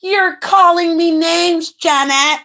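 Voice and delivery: high, drawn-out mock complaint; imitated accuser. Mimicking a woman accusing Janet on stage, high mock complaint. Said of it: "got on the stage and was like".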